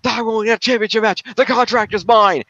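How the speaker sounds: high, emphatic wrestling promo rant; the Miz. Retold or imitated. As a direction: declaim it high and insistent, like a wrestler cutting a promo. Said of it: "where he slams it down and says".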